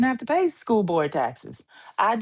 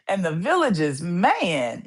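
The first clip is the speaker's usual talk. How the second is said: ordinary talk, nothing marked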